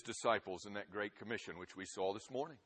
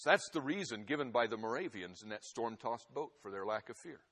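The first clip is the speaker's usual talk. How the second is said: ordinary talk, nothing marked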